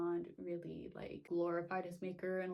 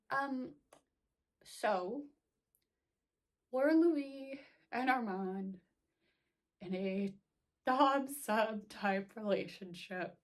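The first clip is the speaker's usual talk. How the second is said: awkward question; 3 semitones higher, 3 dB louder, slower than usual. Retold or imitated. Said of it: "text my friend and be like".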